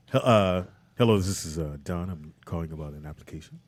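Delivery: low, formal caller voice; polite caller on the phone; telephone voice. Pretend. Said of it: "I'll go".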